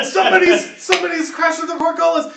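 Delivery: high, loud guard's cry of alarm; alarmed shout. Acted. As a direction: raise the alarm across a castle yard. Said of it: "somebody shouts".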